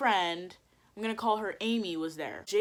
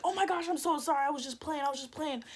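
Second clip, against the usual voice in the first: light, high mock apology; mimicked apologetic friend; 5 semitones higher, faster than usual. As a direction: imitate a friend gushing an apology.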